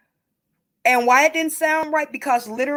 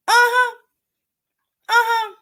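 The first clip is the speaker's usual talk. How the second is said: sassy uh-huh; 10 semitones higher, 4 dB louder than usual. Real, not acted. Sarcastic knowing interjection.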